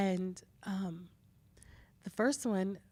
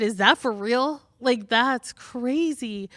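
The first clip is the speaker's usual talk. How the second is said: loud, raised outrage; indignant disbelief; 4 semitones higher, 9 dB louder than usual. Retold or imitated. Midwife reacting to the teen mom's long wait, loudly indignant. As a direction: sound appalled at the delay. Retelling outrage at delayed care.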